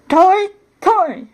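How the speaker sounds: high, sharp call of a name. A narrator's reading. Aunt at the princess's bedroom door, calling out. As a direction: call through a closed door. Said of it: "her aunt called".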